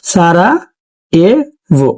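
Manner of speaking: very slow Sarajevo, broken into Sara, e, vo; spelling it out. Shown as a demonstration. Teacher writing the English spelling for students, Sarajevo slowly syllable by syllable. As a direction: draw out Sarajevo piece by piece with pauses. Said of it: "সারায়েভোকে ইংলিশে কি লেখে".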